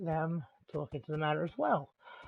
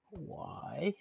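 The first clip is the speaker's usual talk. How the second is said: a breathy stage whisper of a single word; 8 dB quieter than usual. Real, not acted. Whispering a teasing question to his viewers.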